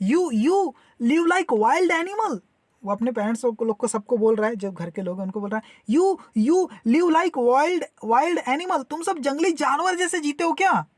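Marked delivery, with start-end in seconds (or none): 0.1-2.4 high-pitched, lightly acted reproach; mock-scold in a raised pitch; acted exasperation, drawn out slowly; excited scolding. Excited son scolding his parents. 6.0-10.9 higher-pitched acted reproach; lightly acted indignant outburst; excited scolding. Excited son scolding his parents.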